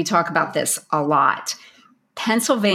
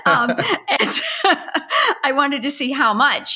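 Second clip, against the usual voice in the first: laughing; 6 semitones higher than usual. Real, not acted. Giggling fit mid-sentence.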